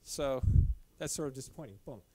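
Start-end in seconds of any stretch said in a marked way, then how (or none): none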